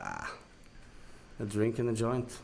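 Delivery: ordinary delivery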